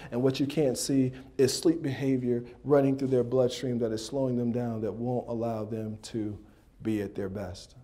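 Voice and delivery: ordinary talk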